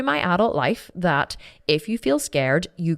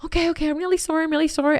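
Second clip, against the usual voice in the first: high, fast pleading; frantic apology; 11 semitones higher, faster than usual. Retold or imitated. Girl apologizing quickly to furious sister, high. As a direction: apologize in a rush.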